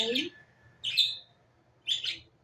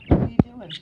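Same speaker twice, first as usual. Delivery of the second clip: loud sharp call to the dog; 3 semitones higher, 13 dB louder, faster than usual. Real, not acted. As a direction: call out over the room. Calling the dog.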